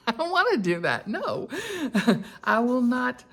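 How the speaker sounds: laughing. Real, not acted. Laughs at pageant shoes.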